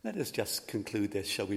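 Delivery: usual speaking voice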